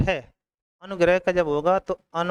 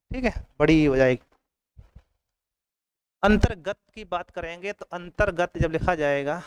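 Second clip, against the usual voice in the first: ordinary delivery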